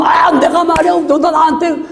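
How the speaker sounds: high-pitched, acted huffy protest; indignant outburst. Offended believer confronting a critic, acted indignation. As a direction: protest as if your pride is stung. Acted quarrel of wounded pride.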